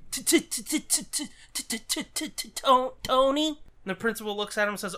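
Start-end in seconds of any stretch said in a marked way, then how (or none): 0.1-3.5 stutter. Acted. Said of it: "The kid goes".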